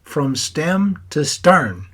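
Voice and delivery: Irish accent imitation. Grandson laughing with Aunt Helen, aping Pops's Irish accent. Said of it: "your Irish accent".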